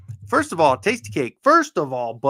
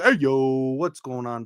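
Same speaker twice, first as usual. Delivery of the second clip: normal talking voice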